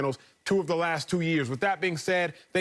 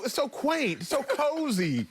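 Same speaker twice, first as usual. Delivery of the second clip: higher, slow, overly sweet praise; fake polite; 7 semitones higher, slower than usual. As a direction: pretend to love the tiny house. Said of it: "you gotta start using words like quaint".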